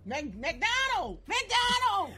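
high, loud mocking McDonald's; excited teasing. Real, not acted. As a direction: rise up and shout McDonald's. Teasing fast food habits.

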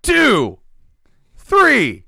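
loud, high drawn-out shout, falling at the end; imitating a concert crowd's count-off. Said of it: "Everyone's shouting".

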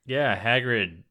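normal talking voice

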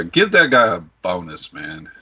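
ordinary delivery

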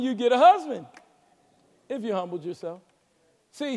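usual speaking voice

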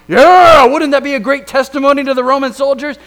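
loud, high, fast taunting call; mocking jeer. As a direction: jeer at him like the crowd. Mocking the crowd at the cross.

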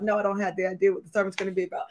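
very fast, low-key rattled-off reply; defeated quick answer. Retold or imitated. Preacher answering her production colleague, rushed low-key admission. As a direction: rush it out under the question. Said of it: "she knows that I'm crying all day".

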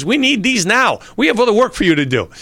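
high, loud, fast telling-off; retold chef in a rush; impatient chef. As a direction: hurry him up like a busy boss. Chef hurrying him.

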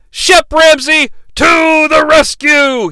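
hammed-up hero yell; heroic shout. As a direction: announce the rescue like a cartoon hero. Hero dives to save kid.

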